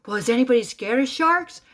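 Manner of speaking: loud, high imitated question; calling to audience. Medium asking a crowd about sharks, imitated calling out. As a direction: throw it out to the whole room.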